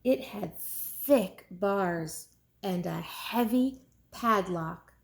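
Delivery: ordinary delivery, nothing marked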